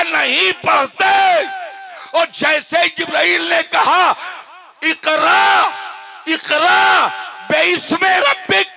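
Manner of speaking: shouted, high sermon climax; preaching at full force. Acted. Preacher dramatizing the revelation to listeners, shouted at full force. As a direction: thunder it out to the congregation.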